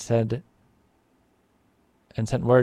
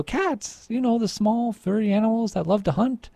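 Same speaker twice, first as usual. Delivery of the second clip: much higher, bubbling with enthusiasm; excited explaining; 9 semitones higher than usual. A narrator's reading. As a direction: explain it eagerly, way up high. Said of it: "said Antonio".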